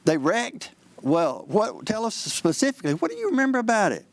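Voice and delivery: mock surprise rising into high, eager questioning; feigned fresh interest. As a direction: act like it's news to you. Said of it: "act like you've never heard it before".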